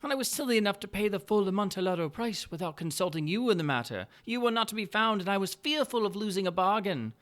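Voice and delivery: butter him up with mock delight; Montresor's sly greeting. Montresor explains his purchase.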